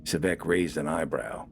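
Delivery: ordinary delivery, nothing marked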